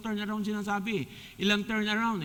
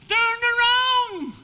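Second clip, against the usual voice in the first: very high, slow, loud yell; shouting; 9 dB louder, much slower than usual. Retold or imitated. The crowd yelling at the runner, high and stretched. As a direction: holler across the field. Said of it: "Yung iba po sumisigaw".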